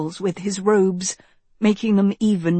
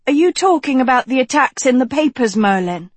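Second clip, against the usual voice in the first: fast, higher, louder interjection; cutting in; 7 semitones higher, 7 dB louder, faster than usual. A narrator's reading. Girl interrupting to ask the wizard, cutting in. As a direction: jump in quickly with an urgent question. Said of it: "Agatha cut in".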